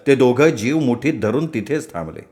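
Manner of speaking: ordinary delivery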